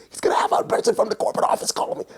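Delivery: high, fast squeaky whisper; hushed giddy glee. Retold or imitated. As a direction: act out a schemer stifling excitement. Schemers secretly gleeful during phone call.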